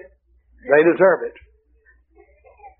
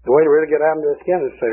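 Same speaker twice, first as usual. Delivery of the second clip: ordinary delivery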